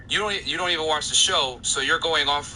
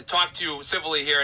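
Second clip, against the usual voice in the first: ordinary talk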